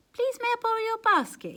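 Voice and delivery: high, sweet polite request; asking nicely. Acted. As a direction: ask as nicely as you can. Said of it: "he asked nicely".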